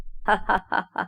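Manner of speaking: put-on joking chuckle; forced laugh. A narrator's reading. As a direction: laugh it off, pretending to joke. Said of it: "اتصنع الهزار وقال".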